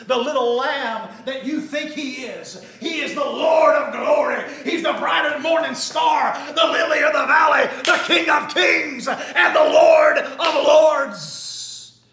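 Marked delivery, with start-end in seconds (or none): 2.8-12.0 louder and louder, slowing at the end; preaching at full force. Acted. Preacher crowning Jesus' titles to the congregation, full-force preaching. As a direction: build the titles to a roaring climax.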